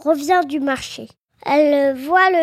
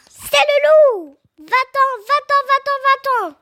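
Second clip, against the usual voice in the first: crying out in alarm; 10 semitones higher, 4 dB louder than usual. A narrator's reading. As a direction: shriek the warning as a little kid. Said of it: "crie la biquet".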